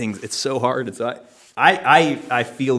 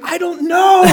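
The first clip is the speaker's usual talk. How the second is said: high confused squeak; 6 dB louder than usual. Retold or imitated. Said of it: "I'm like".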